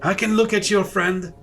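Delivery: offering help as a kindly stranger; villager voice. Acted. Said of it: "one of them says".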